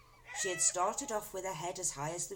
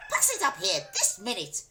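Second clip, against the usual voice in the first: louder, bossy command; demanding grandma; 4 semitones higher, 9 dB louder than usual. A narrator's reading. Grandma demanding the cup from George. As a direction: order it handed up.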